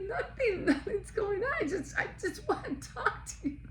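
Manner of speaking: high, squeaky laughing reassurance; words squeezed out through giggles. Retold or imitated. The boss telling coworkers nothing's wrong, laughing.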